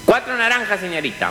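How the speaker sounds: high-pitched pupil voice; schoolchildren answering. Acted. Pupils answering their teacher's sum, high childlike voice. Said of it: "Los chicos cuentan".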